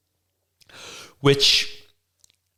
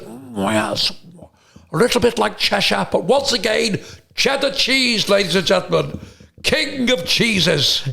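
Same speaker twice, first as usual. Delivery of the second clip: posh comic host impression; 6 semitones higher than usual. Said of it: "And he goes".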